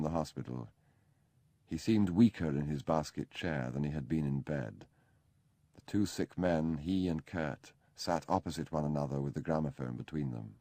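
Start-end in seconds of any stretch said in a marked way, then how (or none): none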